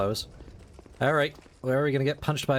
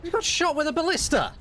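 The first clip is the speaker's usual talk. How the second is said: high, pleading cries; begging them to stop; exasperated; 11 semitones higher, slower than usual. Real, not acted. Pleading with hostile archers.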